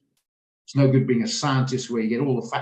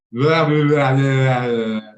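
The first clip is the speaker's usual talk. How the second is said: loud, dismissive blah blah; hammed-up blustering; mock ranting; 8 dB louder, much slower than usual. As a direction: parody his own blustering rant. Mocking his own ranting.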